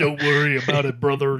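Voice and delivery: light pro wrestler promo voice. Retold or imitated. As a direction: talk like a pumped-up wrestler. Wrestler voice again.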